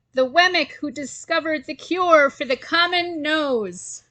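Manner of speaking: mayor hails Willie, shouting. A narrator's reading. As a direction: proclaim the hero to the crowd. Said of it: "shouted the mayor".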